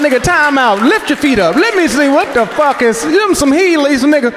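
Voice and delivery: mock demanding. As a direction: demand to check his shoes, amused. Demanding to see man's feet.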